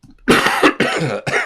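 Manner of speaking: a real hacking cough, loud; loud dry cough. Real, not acted. Painter with a cough today, coughing loudly. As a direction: cough hard into the mic. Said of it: "I have a cough today".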